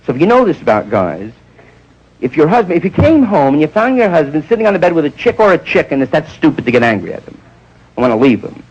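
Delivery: usual speaking voice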